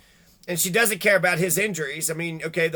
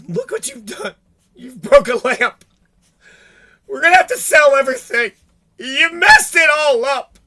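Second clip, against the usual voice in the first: high, loud, mocking scold of a parent; much higher and louder, hammed-up nagging mother; mock shrill scolding; 7 semitones higher, 10 dB louder than usual. Host mocking the stepmother yelling at the child. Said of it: "talking to Gannon like an asshole".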